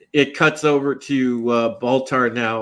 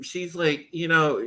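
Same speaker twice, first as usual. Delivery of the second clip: ordinary delivery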